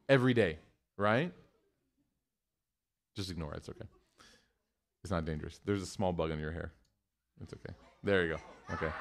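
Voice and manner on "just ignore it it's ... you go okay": low, soft aside to a listener; gently calm someone, keeping it hushed; quiet reassurance. Real, not acted. Calming someone about a bug.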